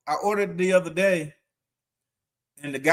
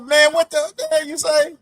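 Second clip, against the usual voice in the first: incredulous; 10 semitones higher, 4 dB louder than usual. Retold or imitated. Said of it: "I said".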